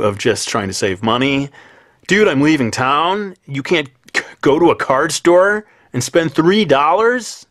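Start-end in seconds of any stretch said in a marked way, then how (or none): 2.1-7.5 loud, slow stoner-surfer drawl; surfer dude. Retold or imitated. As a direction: play a laid-back surfer dude. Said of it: "the voice of a surfer dude".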